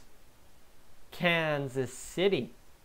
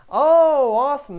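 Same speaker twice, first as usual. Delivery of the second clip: loud, high burst of delight; excited shout; 10 semitones higher, 14 dB louder, slower than usual. Real, not acted. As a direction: yell it out thrilled by the news. Streamer reacts to sub news.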